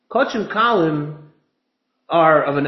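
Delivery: ordinary talk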